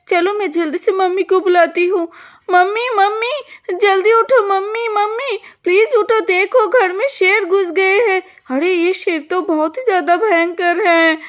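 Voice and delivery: put-on a little girl begging her mother to wake; frightened girl. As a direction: daughter calling mummy about the lion. Pinky scared, waking mother.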